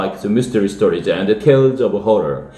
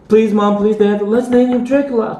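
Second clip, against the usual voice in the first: put-on high, pleading child's cry; 11 semitones higher than usual. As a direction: beg your parents with everything. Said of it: "cried Toby".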